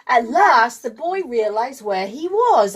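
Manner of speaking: ordinary delivery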